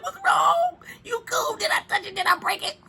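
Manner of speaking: high, panicked worried questions; worried mama. Retold or imitated. Owner panicking after her dog yelps, high worried voice. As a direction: fret over him in a rush. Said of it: "So I be like".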